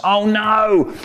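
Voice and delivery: high, drawn-out mock dismay; hammed-up alarmed cry; cry of dread. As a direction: wail it with rising dread. Acted dread at the zombie.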